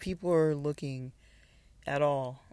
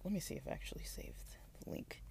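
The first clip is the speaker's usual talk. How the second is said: whispering; 3 semitones higher, 13 dB quieter than usual. Real, not acted. Muttering to self at computer.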